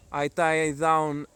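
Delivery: ordinary talk, nothing marked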